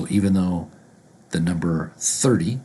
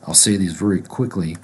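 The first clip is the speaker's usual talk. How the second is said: ordinary talk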